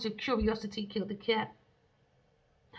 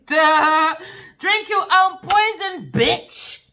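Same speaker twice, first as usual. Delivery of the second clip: loud, high, slow shouted insult; loud angry command; furious yell; 8 semitones higher, 13 dB louder, much slower than usual. Acted. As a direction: scream it at the traitor. Yelling at the betrayers.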